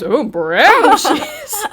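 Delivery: very slow, drawn-out, laughing through it; a light imitation of a barman sneering at cheese; mock unimpressed. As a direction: stretch it out like a barman who is not impressed. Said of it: "he even goes".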